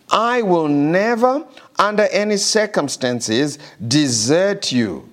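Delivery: high, slow, fervent scripture reading; soaring preacher's cadence, dragging out never; preaching at full force. Acted. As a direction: proclaim every word like a promise from on high. Preaching God's promise.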